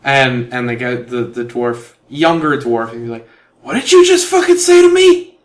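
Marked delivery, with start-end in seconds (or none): 3.6-5.4 high, loud furious yell; angry shout. Acted. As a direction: scream it at him in rage. Younger dwarf threatens the player.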